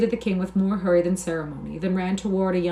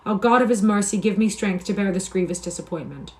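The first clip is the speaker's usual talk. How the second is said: plain reading voice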